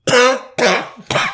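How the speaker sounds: loud, high hacking cough; hammed-up coughing fit; choking cough. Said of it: "It sounds like a donkey choking".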